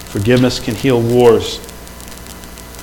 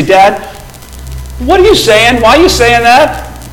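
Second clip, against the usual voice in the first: mock puzzled child; 8 semitones higher, 3 dB louder than usual. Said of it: "my youngest will look at me and say".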